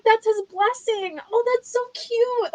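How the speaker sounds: hammed-up swoon over a sweet moment; gushing high voice. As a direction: coo it like a proud fangirl.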